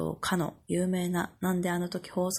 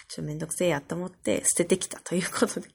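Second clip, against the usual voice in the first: ordinary delivery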